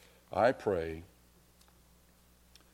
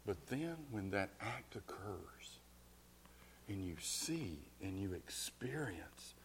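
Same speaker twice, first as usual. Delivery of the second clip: hushed whisper; 8 dB quieter than usual. Acted. Preacher hushing the congregation about love's depth, whispered. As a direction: draw them in with a whisper.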